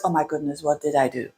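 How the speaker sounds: hushed, low aside; acting out a quiet "oops" to oneself; dismayed self-talk. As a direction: drop right down, as if thinking aloud. Acts dismayed afterthought to oneself.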